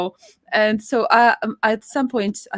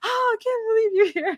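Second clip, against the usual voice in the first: excited squeal; 13 semitones higher than usual. Retold or imitated. Greeting friend at convention.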